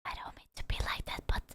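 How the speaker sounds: fast hushed whisper; whispered aside. Real, not acted. Streamer whispering a reluctant aside to chat. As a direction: lean in and whisper quickly.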